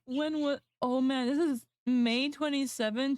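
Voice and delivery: ordinary talk, nothing marked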